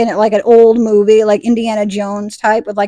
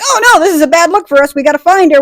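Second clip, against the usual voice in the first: high, louder acted worry; mock panic; 6 semitones higher, 5 dB louder than usual. Vampires must find the missing girl, mock panic. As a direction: fret loudly in mock alarm.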